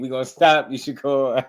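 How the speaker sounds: high mock threat through laughs; teasing imitation while laughing; imitating the threat again. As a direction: parrot the threat, cracking up. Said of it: "That don't even sound believable".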